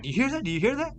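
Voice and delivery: high-pitched, insistent questioning; urgent retold question. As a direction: act out asking your wife in alarm. Retold alarmed question to wife.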